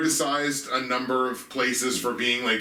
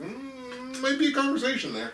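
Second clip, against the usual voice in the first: high, quick teasing voice; high mock tease; 12 semitones higher than usual. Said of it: "And I was like".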